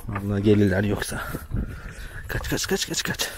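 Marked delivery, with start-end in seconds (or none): 2.3-3.3 urgent whispered escape, words almost voiceless; hushed whisper. Real, not acted. Sneaking past the sheep so they won't follow, in a hushed whisper. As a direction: whisper so the sheep don't hear.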